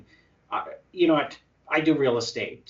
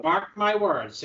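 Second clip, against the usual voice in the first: slow, higher-pitched pronouncement; slow proclamation; 5 semitones higher, slower than usual. Retold or imitated. Retelling his prediction on that tour, slowly proclaimed. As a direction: draw out each word like a prophecy.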